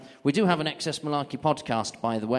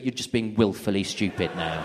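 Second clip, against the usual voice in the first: normal talking voice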